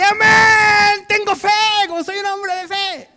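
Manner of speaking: very high, drawn-out yell of "Amén"; triumphant shout. Retold or imitated. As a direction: shout your faith out at full voice. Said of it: "y grité". (grito triunfal de fe)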